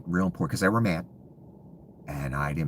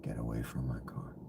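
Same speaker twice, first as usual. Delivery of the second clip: soft, breathy menacing whisper, much softer; echoing his own shout as a quiet threat; 6 semitones lower, 12 dB quieter than usual. Pretend. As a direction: hiss the order under your breath. Repeats the order, now whispered.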